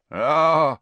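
dark grunt. A narrator's reading. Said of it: "said Ern, darkly".

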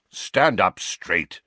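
slow, curt impatient order. A narrator's reading. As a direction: snap it out, losing patience. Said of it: "said Sirius impatiently".

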